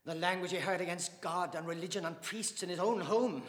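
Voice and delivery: loud, high indignant rebuke; hot indignation. Acted. Dante accusing the father of blasphemy, hotly indignant. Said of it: "said Dante hotly".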